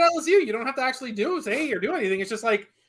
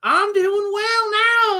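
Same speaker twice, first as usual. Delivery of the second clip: higher, slow drawn-out put-on Southern accent; mock Southern drawl; 11 semitones higher, much slower than usual. Cohost imitating himself rich, mock Southern drawl. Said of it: "it would be like".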